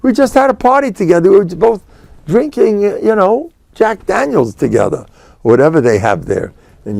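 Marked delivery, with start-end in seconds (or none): 0.0-5.1 much higher, pleading disbelief; pleading friend. Retold or imitated. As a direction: plead with your old drinking buddy. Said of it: "And the guy says".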